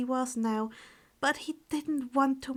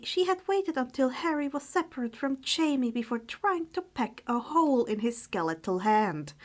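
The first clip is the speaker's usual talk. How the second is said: ordinary delivery, nothing marked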